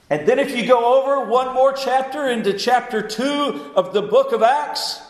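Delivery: high-pitched, soaring sermon delivery; impassioned preaching. Real, not acted.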